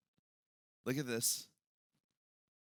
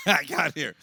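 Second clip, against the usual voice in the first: ordinary talk, nothing marked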